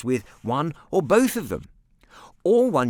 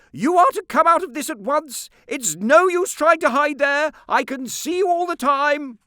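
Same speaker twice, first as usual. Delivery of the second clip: high, loud scolding aunt voice; 9 dB louder than usual. A narrator's reading. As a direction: bark it out as the cross aunt calling across the garden.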